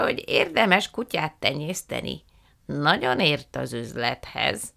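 put-on high, slow, drawn-out old lady voice; effusive old aunt. A narrator's reading. Aunt Fan welcoming the guests, slow and high. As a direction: stretch every word with delight.

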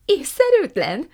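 high, loud incredulous cry; shouting. A narrator's reading. Prue throws mama's word back at her, shouting. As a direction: cry out in disbelief, stretching the word. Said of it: "kiáltotta Prue".

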